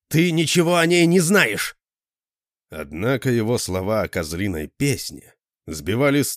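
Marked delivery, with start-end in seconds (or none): 0.1-1.7 loud, high, angry yell; furious shout. A narrator's reading. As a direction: shout him down in fury. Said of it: "взорвался я".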